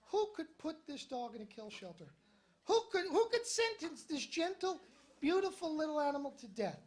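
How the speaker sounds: soft, quiet sentimental lament; tender indignation. Retold or imitated. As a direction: murmur it softly over the trembling dog. Tender musing over nervous dog.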